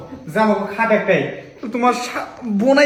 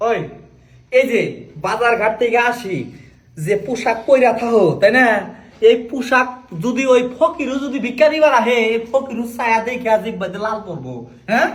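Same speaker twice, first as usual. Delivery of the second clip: high scolding; 7 semitones higher, 3 dB louder than usual. Acted. Husband scolding wife's clothes.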